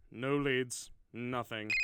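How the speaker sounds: ordinary delivery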